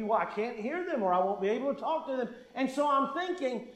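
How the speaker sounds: ordinary talk, nothing marked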